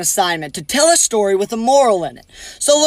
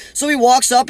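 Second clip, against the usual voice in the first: ordinary talk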